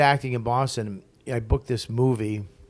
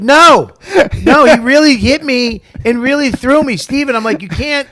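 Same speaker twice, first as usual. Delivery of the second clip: much higher and louder, frantic; panicked protest; 12 semitones higher, 11 dB louder than usual. Retold or imitated. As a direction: protest in shocked alarm. Protesting after being hit.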